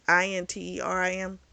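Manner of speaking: I N T E R I M said letter by letter; spelled out. Shown as a demonstration. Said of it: "I know I'm not saying it right".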